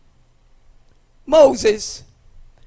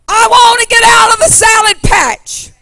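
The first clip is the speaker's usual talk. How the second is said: loud, high pleading preacher's cry to the Lord; full-force preaching; 10 semitones higher, 9 dB louder than usual. Acted. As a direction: shout the prayer out to God.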